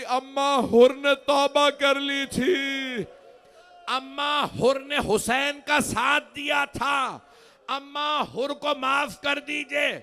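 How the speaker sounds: high, slow, loud wailing plea; mournful plea. Acted. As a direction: cry out to the mother for mercy. Zainab's plea, high slow lament. (بلند آواز میں پُرسوز فریاد)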